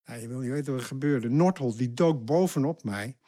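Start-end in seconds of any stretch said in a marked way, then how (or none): none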